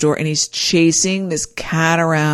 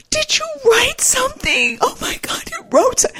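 astonished. Retold or imitated. Bride amazed her groom wrote his own vows, breathless. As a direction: gush in disbelief at the surprise. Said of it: "I go".

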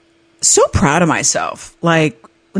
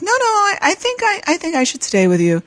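high insistent voice; 12 semitones higher than usual. Retold or imitated. Man insisting on staying.

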